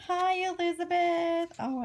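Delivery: high, slow doll-greeting coo. Real, not acted. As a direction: coo hello to a beloved doll.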